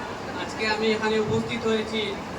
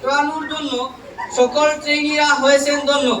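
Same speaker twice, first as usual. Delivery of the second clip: loud, measured public recitation of verse; poem recitation; 2 semitones higher, 7 dB louder than usual. Acted. Trainee reciting a farewell poem to college teachers, declaimed loudly. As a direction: declaim rhymed tributes line by line, pausing between phrases.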